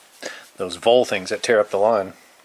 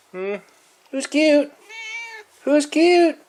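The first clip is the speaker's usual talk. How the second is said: much higher cooing pet voice; slower than usual. Real, not acted. Owner cooing at the cat, much higher baby talk.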